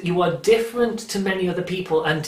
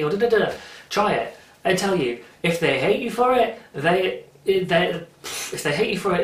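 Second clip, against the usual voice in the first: ordinary delivery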